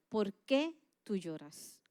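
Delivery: a lightly voiced God speaking to the heart; gentle voice of God. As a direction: ask it slowly and tenderly, as God. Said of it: "Dios habló a mi corazón y me decía".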